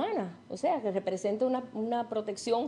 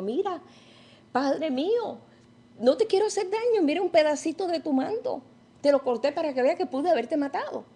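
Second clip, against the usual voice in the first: higher, earnest plea to the king; pleading David; 6 semitones higher than usual. Acted. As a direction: beg him to believe you, voice lifted. David pleads with Saul in cave.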